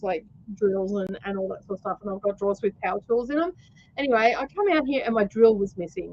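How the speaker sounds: ordinary talk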